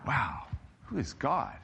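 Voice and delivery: ordinary talk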